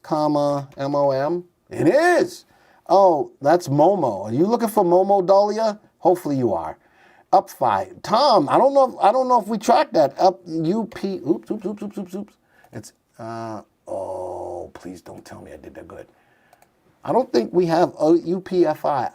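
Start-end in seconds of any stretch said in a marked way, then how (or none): 1.7-2.4 high-pitched note of discovery; surprised. Real, not acted. Finds MOM is a real stock. 11.3-16.1 fading to a barely audible mumble; soft, private dismay at a slip; muttering to self. Real, not acted. Muttering to self over a mistake.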